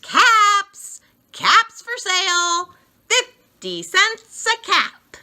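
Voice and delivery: high, loud street cry; calling out. Acted. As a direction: call your wares down the street.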